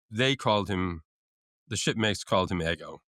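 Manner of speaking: normal talking voice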